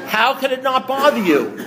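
high, drawn-out disbelief; incredulous. Retold or imitated. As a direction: ask it slowly, astonished. Incredulous retort to calm sponsor.

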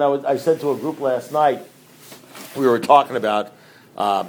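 ordinary talk

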